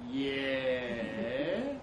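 low, long drawn-out yeah; slow. Acted. Trev waiting for what his mate says, slow. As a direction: stretch the word right out, low. Trev's second slow, wary answer.

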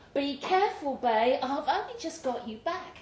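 worried Michelle. Acted. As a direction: plead gently in Michelle's voice.

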